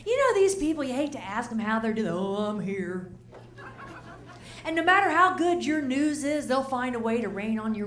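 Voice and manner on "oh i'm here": slow, low, droopy mope; glum moan. Retold or imitated. Negative friend answering how are you, in a slow mope. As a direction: sigh it out like nothing's ever good.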